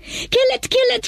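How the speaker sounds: urgent shout. Acted. Villagers urging each other to kill the snake, urgent cry. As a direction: urge them to strike. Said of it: "shouted the people".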